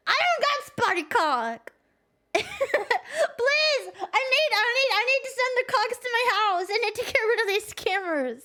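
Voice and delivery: put-on high, laughing, frantic old lady; panicked grandma voice. Streamer playing Bessie begging the scammers, high and frantic. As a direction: wail it like a desperate granny being scammed.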